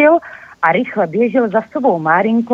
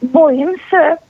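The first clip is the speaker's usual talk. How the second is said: a small scared confession, higher; admitting fear; 8 semitones higher than usual. A narrator's reading. Squirrel confessing fear to friends, mildly scared. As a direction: own up to being afraid. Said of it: "přiznal veverčák".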